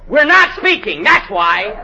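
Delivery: loud, offended snap; huffy retort. Acted. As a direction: announce the feud crossly. Actor sulks in a quarrel.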